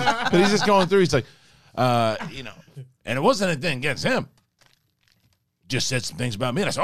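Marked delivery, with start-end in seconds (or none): 3.0-6.6 Al Pacino impression. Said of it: "started watching Pacino".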